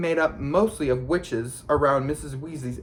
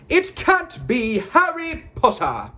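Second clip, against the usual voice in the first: high, slow, loud proclamation; astonished shout; 11 semitones higher, 5 dB louder, slower than usual. A narrator's reading. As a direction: shout it slowly in showy amazement. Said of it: "positively shouted".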